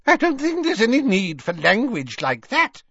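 high-pitched, affronted shopkeeper; high, prim reproof; Madame Malkin. A narrator's reading. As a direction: scold in a high put-on woman's voice. Shopkeeper objects to an insult.

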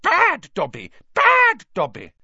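high, loud elf self-scolding; shouting. A narrator's reading. Elf punishing himself at the window, shouting shrilly. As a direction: shout it furiously in a high creature voice.

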